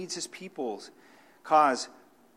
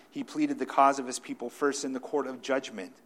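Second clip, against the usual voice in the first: ordinary delivery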